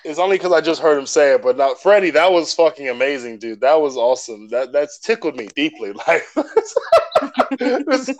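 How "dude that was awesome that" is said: usual speaking voice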